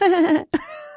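high awkward giggle; nervous laugh. Real, not acted. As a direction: laugh it off, a bit uneasy. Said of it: "That's my nervous laugh".

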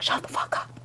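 whispered, breathless disbelief; awed whisper. Retold or imitated. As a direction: hush it like you're too stunned to speak. Friend whispers in awe.

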